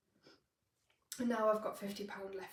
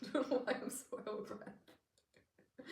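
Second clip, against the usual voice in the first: laughing, breathless; slower than usual. Real, not acted. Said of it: "I sound really out of breath".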